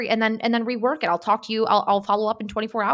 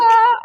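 panicked gasp; 12 semitones higher, 8 dB louder than usual. Retold or imitated. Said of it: "I was like".

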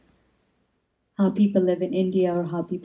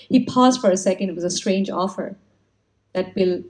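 normal talking voice

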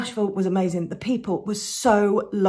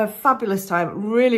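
usual speaking voice

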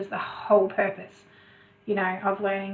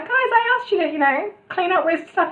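much higher, calling into the room exasperated; 8 semitones higher than usual. Retold or imitated. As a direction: call out to the boys, fed up. Calls the boys about dishes.

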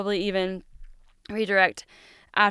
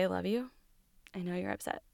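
very soft, soothing parent voice; acted out softly, as if comforting an upset child; gentle reassurance; 9 dB quieter than usual. Mom to her child mid-tantrum, gently reassuring.

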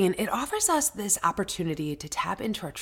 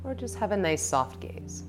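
soft, unhurried meditation voice with long pauses; guided meditation; 4 dB quieter than usual. Acted. Podcast host guiding listeners into a seated practice, calm meditation voice. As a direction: settle the listener gently, leaving space between phrases.

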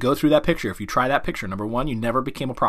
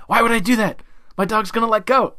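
higher-pitched mock objection; objecting listener; 6 semitones higher than usual. Trainer mimics a worried dog owner's doubts, mock protest. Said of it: "I know what you're thinking".

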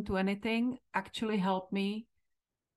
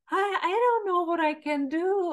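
much higher, strained panic; retold panic, pitch way up; panicked helplessness; 11 semitones higher, 5 dB louder than usual. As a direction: squeak out the helplessness. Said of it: "I was like".

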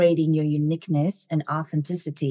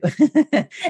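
a quick self-amused laugh; short laugh; 10 semitones higher, 5 dB louder than usual. Real, not acted. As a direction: laugh lightly at yourself. Laughing at her own ums.

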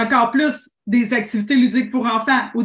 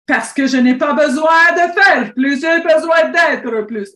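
loud, slow, higher-pitched Russian accent; 4 semitones higher, 4 dB louder, slower than usual. Shown as a demonstration. Coach drives home her message to a client, in a Russian accent. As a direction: proclaim it slowly in a put-on Russian accent. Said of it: "j'aime bien ça prendre mon accent russe pour te dire ça".

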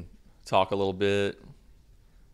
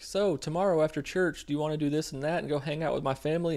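higher, chatty put-on female voice; imitated girlfriend; 8 semitones higher than usual. Girlfriend planning after church, high quick mock chatter. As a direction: pitch it up and run through the plans quickly. Girlfriend making plans, retold.

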